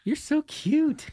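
put-on high, slow baby talk to a puppy; cooing at a dog. [name] greeting the dog, high slow cooing. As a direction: coo it to the cute dog.

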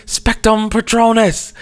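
high drawn-out mock spell shout; mock yell. Quoting Scott Lang casting a spell, high mock yell. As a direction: cast it like a hero. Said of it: "he yells".

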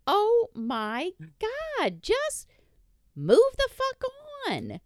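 high, drawn-out exasperation; exasperated outburst. Real, not acted. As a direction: let it rise high in sheer exasperation. Exasperated rant about greedy ex.

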